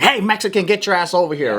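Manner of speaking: high-pitched mock calling-out; mock holler. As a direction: holler like a pushy officer. Acted officer calling someone over.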